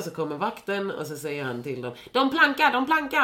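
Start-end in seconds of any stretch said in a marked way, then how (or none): 2.1-3.2 put-on high, loud childish snitching; imitating a man tattling, pitched way up; shrill mocking tell-tale cry. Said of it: "Han golar liksom ut dem".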